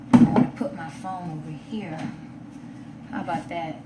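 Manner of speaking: ordinary talk, nothing marked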